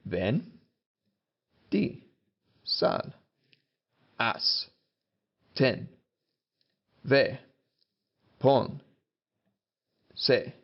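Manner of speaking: "Vin Diesel has ten weapons, eh" broken into Spanish syllables ven, di, sal, haz, ten, ve, pon, sé; Spanish word by word. Shown as a demonstration. Teacher explaining a memory phrase, "Vin Diesel has ten weapons, eh" as isolated Spanish syllables. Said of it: "How does it work?".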